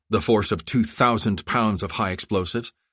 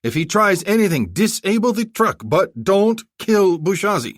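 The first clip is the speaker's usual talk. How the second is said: urgent orders; 13 semitones higher, 6 dB louder than usual. Acted. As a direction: insist on keeping him alive. Commander giving urgent orders.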